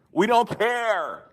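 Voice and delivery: loud, high outcry, stretching the words; exasperated shout. Real, not acted. Ranting about aliens and drones being ignored, exasperated shout. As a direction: shout "We don't care" high and drawn out. Exasperated rant, outburst on repeat.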